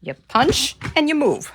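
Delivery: loud, peppy workout chant; aerobics instructor. Acted. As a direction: call it out like leading a fitness class. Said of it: "Do I sound like an aerobics instructor?".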